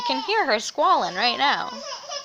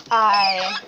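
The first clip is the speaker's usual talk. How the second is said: ordinary delivery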